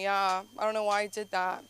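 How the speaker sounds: usual speaking voice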